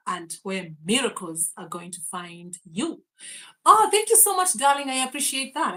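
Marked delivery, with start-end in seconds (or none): 3.6-5.8 much higher and louder, gushing; light up at the gift; thanking a viewer, high and gushing; delighted thanks. Real, not acted. Thanking viewer's kind message.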